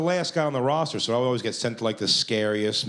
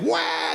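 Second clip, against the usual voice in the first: high-pitched imitated toddler wail; child's wail. Kid sees Pooh's head come off, mock wail. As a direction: burst out in a mock baby cry. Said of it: "And the kid's like".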